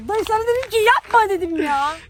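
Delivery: loud, angry protest; exasperated scolding. Real, not acted. Victim scolding the prankster, exasperated shout. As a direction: shout that you warned him. Scolds friend for not stopping.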